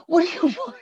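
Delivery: high-pitched mock exasperation; exasperated Tom Hanks. Owner to barking dog, exasperated high imitation. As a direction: plead with a dog that won't stop. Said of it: "He's like".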